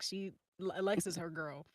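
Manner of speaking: ordinary talk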